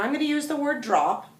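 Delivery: ordinary talk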